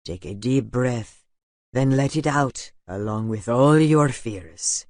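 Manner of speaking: slow, soothing breathing instructions; soothing guidance. A narrator's reading. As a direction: talk him calmly through his fear. Aiden guiding the boy's breathing.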